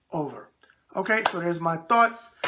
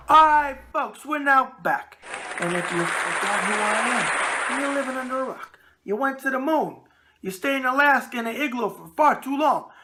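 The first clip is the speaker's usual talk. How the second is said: announcer; 6 semitones higher, 3 dB louder than usual. Acted. Show comes back from break.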